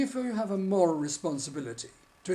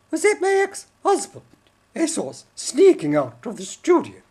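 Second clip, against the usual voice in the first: high loud squawky puppet woman; shrill Judy puppet voice; puppet wife voice; 8 semitones higher, 8 dB louder than usual. Acted. Judy's puppet voice.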